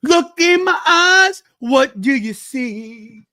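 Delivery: slow, high and loud, stretched out; dramatic villain voice. Acted. Cohost hamming a slow dramatic villain line. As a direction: lean in like a hypnotist. Dramatic bit mid wrestling recap.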